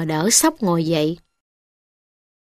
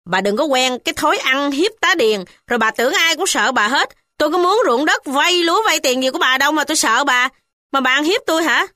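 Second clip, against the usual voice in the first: defiant rebuke; 7 semitones higher, faster than usual. A narrator's reading. Ba Cam rebuking the landowner's mother, bold high voice. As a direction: throw her bullying back at her. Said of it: "Ba Cam nói".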